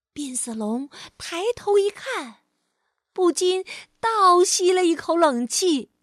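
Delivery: ordinary delivery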